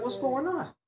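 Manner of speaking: high, drawn-up questioning quote; retold disbelief. Retold look at the teacher.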